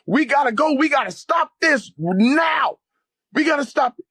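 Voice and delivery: very high, urgent retold cry; strained high pleading, stretching the key words; fired up. Urging his friend to act at once, retold fired-up cry. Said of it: "I was fired up".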